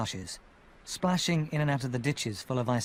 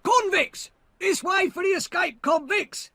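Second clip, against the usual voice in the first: high, loud calling shout; 5 dB louder than usual. Acted. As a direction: call the soldiers over, yelling. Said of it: "shouted the other".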